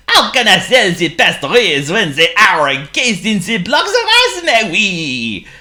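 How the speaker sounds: put-on loud, high French accent, laughing through his grumble; a French baker fuming over frozen stock; grumpy French accent. French baker complains to the penguin about his frozen business, in a loud French accent. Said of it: "the French-Canadian baker".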